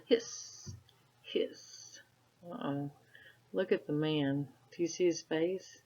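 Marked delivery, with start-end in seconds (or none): 0.1-2.0 softer and softer whispered hiss, hiss; whispered kettle hiss. Acted. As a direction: let the kettle fade away. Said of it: "the tea kettle whistled".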